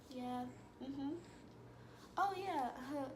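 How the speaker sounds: very soft, minimal acknowledgements; quiet, reserved replies. Retold or imitated. Shy new student answering classmates' questions, quiet and withdrawn. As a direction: imitate shyly murmured small replies. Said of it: "I was just quiet".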